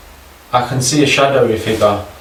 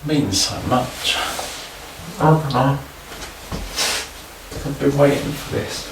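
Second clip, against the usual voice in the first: getting emotional; 8 dB quieter than usual. Real, not acted. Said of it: "I'm getting emotional now".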